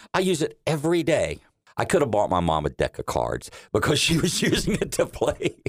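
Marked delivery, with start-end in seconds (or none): 0.1-1.4 higher voice, playing his proud mother; mom's proud reply. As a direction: lightly imitate mom boasting she uses it daily. Son voices mom's proud answer.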